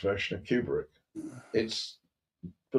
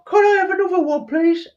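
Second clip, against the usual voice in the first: very high, loud squeaky Cockney beggar; mock Cockney urchin; 6 dB louder than usual. Urchin begging the governor for a free ticket, squeaky Cockney. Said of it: "I heard your Cockney accent".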